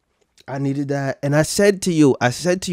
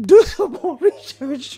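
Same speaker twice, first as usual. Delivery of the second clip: laughing mock whisper; 12 semitones higher than usual. Mocking the line while laughing.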